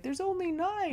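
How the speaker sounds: high panicked squeal; freaking out. Retold or imitated. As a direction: yelp it in disbelief. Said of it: "the guy's freaking out".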